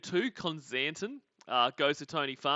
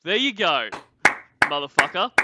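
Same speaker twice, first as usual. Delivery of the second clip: mock triumph; 3 semitones higher, 9 dB louder than usual. Triumphant jab at absent Lorne.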